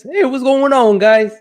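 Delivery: loud, high hype greeting; excited greeting. Real, not acted. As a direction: call out to the chat. Live stream greeting shout.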